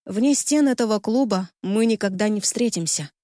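ordinary delivery, nothing marked

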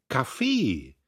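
stubborn "Kaffee"; insistent repeat. A narrator's reading. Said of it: "Magda wiederholte".

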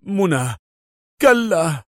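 anguished call rising high; Adham crying out. A narrator's reading. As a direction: cry out as a comrade falls. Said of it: "واستدار إليها صارخا".

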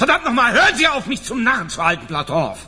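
furious, cracking shout; furious outburst. Acted. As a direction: blow up at him in a rage. General loses his temper.

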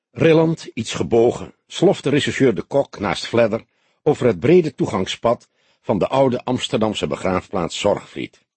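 ordinary delivery, nothing marked

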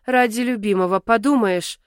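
plain reading voice

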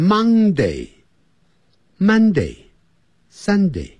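Monday and Monday said as the wrong sound, then Sunday with the right vowel; teacher warning against a Monday-like Sunday. Shown as a demonstration. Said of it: "也不要念成Monday".

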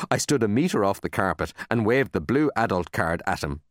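ordinary delivery

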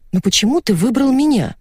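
mildly taken aback; surprised. A narrator's reading. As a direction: wonder at being chosen. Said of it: "удивилась я".

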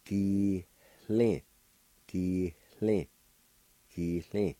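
Di-lin with a breathy H before the L, said slowly; old pronunciation. Shown as a demonstration. Teacher demonstrating the old way for learners, careful pronunciation. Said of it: "like this".

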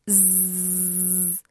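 long, low, soft buzz; sustained zzz of rushing air; buzzing air hiss. A narrator's reading. Jacques turns on the air valve, steady buzzing noise.

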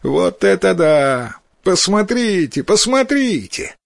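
high, jeering cry climbing in pitch; mocking. A narrator's reading. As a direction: make fun of a classmate for all to hear. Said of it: "стал потешаться над бедным Вакамацу".

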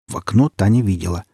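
plain reading voice

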